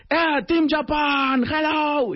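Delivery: high, drawn-out hail to a crowd; calling out. Retold or imitated. Speaker hails the Japanese team at the break, calling out.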